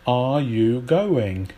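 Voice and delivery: "Are you going?" said slowly, each word drawn out, with the auxiliary "Are" spoken in full; slow full question. Shown as a demonstration. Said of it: "lose auxiliary verbs".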